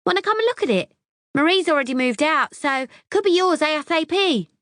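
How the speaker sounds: very high, loud, gushing recognition; gushing airhead voice. A narrator's reading. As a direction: squeal like an excited ditz. Debs eager.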